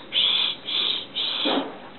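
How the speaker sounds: put-on whispered shh like a mommy hushing a baby; breathy shushing whisper. Grandma shows the mommy on the bus, whispering shh. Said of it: "What does the mommy say?".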